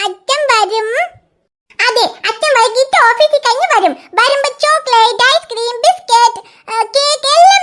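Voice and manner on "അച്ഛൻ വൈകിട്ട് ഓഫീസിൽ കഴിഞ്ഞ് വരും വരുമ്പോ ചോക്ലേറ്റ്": ordinary talk, nothing marked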